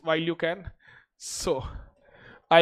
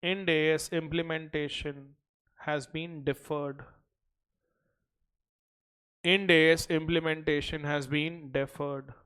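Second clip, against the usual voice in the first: slow, even dictation pace; slower than usual. Acted. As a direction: read it out for notes. Dictates notes to class.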